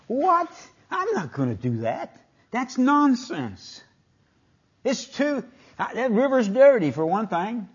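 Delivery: indignant. Retold or imitated. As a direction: ham up a proud general's offended refusal. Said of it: "He was indignant".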